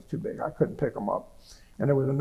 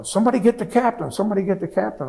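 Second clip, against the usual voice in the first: half-voiced yell, pitched up; retold yell; 7 semitones higher, faster than usual. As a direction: imitate an urgent call for help. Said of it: "I hear the lieutenant yelling".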